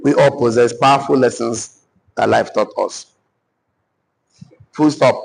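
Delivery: deliberate, phrase-by-phrase dictation; drawn-out openings, pauses for writing. Acted. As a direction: spell out each phrase for note-takers, pausing between. Dictates notes to the audience.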